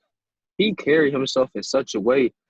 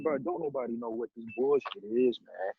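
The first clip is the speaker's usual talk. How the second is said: muttered complaint; 6 semitones lower, 10 dB quieter than usual. Retold or imitated.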